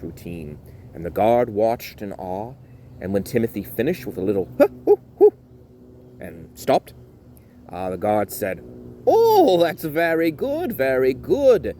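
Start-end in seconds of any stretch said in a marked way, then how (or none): 4.6-5.3 high, loud huffing hoots; dance finish huffs. Acted. Timothy finishing his tap dance with loud high huffs. 9.1-11.8 high delighted guard; guard's praise. Acted. Guard praising Timothy's dance, high and delighted.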